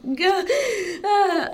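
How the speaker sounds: laugh breaking into a cry. Real, not acted. Overwhelmed recalling crying.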